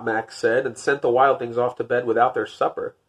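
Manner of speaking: plain reading voice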